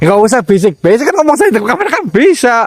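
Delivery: high, remonstrating tone; exasperated protest. Real, not acted. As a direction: voice climbing high in complaint. Protests again at the whispering.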